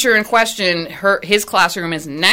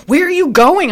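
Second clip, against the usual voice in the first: sharp demand; 11 semitones higher, 6 dB louder than usual. Retold or imitated.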